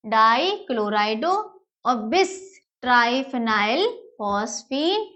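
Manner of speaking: measured, piece-by-piece naming, slow; slow read-back. Shown as a demonstration. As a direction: read the name back slowly in chunks. Reads back the compound name. (टुकड़ों में धीरे-धीरे दोहराना)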